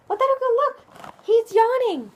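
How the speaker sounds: thrilled discovery; excited. Real, not acted. Pointing out yawning truck.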